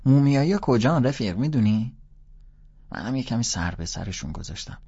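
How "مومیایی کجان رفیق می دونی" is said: a high, loud schoolboy question; a kid pestering a stranger, pitched up and loud; kid asking loudly. A narrator's reading. Boy asks Holden where the mummies are, high and loud. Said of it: "دوباره پرسید".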